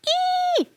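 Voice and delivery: very high thin squeal; eerie little scream. Acted. Fairy dragged away, high eerie scream. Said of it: "The little fairy screamed".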